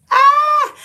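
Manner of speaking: high loud squeal; excited shriek; delighted squeal. Real, not acted. Excited at shoulder pads.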